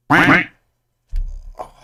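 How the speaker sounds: sudden loud, high choked oh; real startled catch of breath; caught mid-breath. Real, not acted. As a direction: let the breath catch sharply on it. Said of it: "got me, like, mid-breath again".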